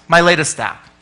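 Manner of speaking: normal talking voice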